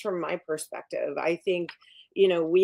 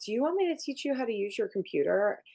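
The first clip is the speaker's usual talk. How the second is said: higher, quick imitated roommate; pitched-up, brisk helpful question; eager roommate; 6 semitones higher, faster than usual. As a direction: rattle off the offer in a lifted voice. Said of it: "And the roommate's like".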